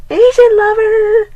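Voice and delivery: very high, slow, loud callout; drawn-out hype shout; hype announcement. Real, not acted. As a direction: announce him like a wrestler entering. Announcing a friend's arrival.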